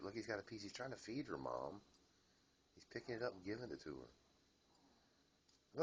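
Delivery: normal talking voice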